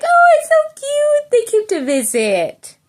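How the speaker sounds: high squeal of delight; squealing oh it's so cute; gushing over cute kitties. Retold or imitated. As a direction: coo like you just saw kittens. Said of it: "And then I'm like".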